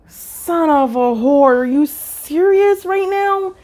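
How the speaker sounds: high, slow, incredulous cry; exasperated disbelief. Retold or imitated. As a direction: act stunned and fed up. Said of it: "And you're like".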